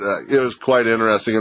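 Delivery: normal talking voice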